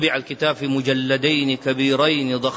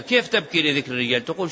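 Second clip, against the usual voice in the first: ordinary talk, nothing marked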